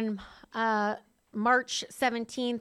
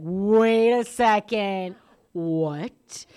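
loud, drawn-out stunned reaction; mock astonishment; 8 dB louder, much slower than usual. Reacting to friend about the twelve steps, exaggerated shock. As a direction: stretch it out loudly, then drop in disbelief. Acts out her startled realization.

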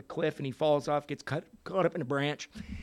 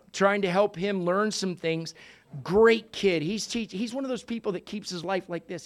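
usual speaking voice

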